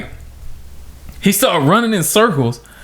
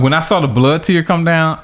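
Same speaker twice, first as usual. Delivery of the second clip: ordinary talk, nothing marked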